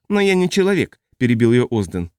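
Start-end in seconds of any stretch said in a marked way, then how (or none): 0.1-1.0 high, testy, prickly man's voice; irritable high-pitched man. A narrator's reading. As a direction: snap back, high and peevish. Ozden's voice again.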